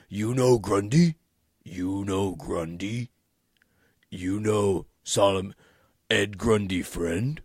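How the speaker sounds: lightly voiced slow brute, halting simple words; a hint of a lumbering monster asking; Grundy voice. Recapping Gotham, imitating Grundy's slow halting talk. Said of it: "That's how he talks".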